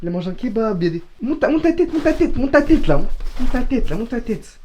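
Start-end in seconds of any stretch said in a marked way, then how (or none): 1.2-4.6 loud, high repeated angry orders; angry shouting. Acted. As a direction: bark at him to look up. Ordering brother to show face.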